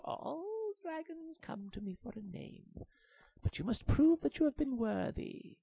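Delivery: high, solemn sage voice; wise old monkey. A narrator's reading. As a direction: pronounce it like an oracle. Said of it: "monkey answers".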